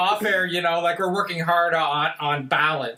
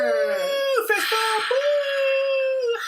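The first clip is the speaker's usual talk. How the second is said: high, whooping cheer; cheering at a game; much slower than usual. Pretend. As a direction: whoop it up for the host. Hyping the host's entrance.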